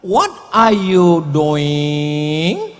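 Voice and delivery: very slow What are you doing? in English style; English drawl. Shown as a demonstration. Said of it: "nggak ada beda". (logat Inggris sangat pelan)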